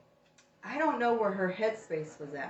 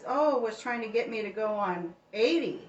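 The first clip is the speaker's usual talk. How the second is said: ordinary talk, nothing marked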